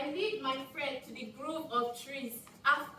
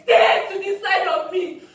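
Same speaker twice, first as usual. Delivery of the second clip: loud, higher-pitched warning cry; urgent shout; 4 semitones higher, 14 dB louder than usual. Acted. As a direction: shout an urgent order to protect your friend. Warns companion as attackers close in.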